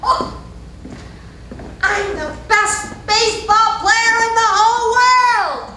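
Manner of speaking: loud, high, drawn-out boast; bragging little boy. Acted. Little boy on the baseball field, bragging loudly to himself. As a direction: crow it out like a cocky kid.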